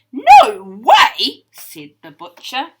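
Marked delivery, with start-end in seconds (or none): 0.1-1.5 loud, higher refusal yell; defiant shout. Acted. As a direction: shout it back stubbornly.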